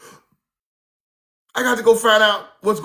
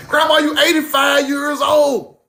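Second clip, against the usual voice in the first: amazed; 6 semitones higher than usual. Retold or imitated. Amazed at grandma skinning deer.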